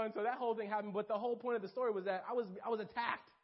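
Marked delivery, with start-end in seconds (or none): none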